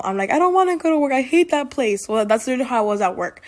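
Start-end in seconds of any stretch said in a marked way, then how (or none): 0.3-2.1 imitating crying whine. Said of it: "when I'm crying at home".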